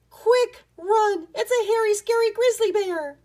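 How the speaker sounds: high, loud storybook cries of alarm; panicked alarm. A narrator's reading. Rat Pack fleeing grinning bear Boris, panicked alarm. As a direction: act out terrified rats shrieking at a bear.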